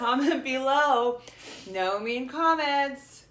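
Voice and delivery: slow, drawn-out plea to viewers; slow emphatic appeal. Acted. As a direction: stretch each word to the audience. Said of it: "addressing viewers".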